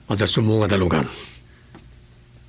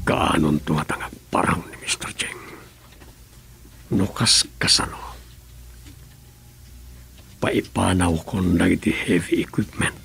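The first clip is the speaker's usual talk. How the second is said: whisper; 3 semitones lower than usual. Acted. Informant whispering news to the governor, hushed whisper. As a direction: keep it hushed so no one overhears. Secret report about Mister Cheng.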